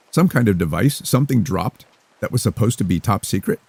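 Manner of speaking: ordinary delivery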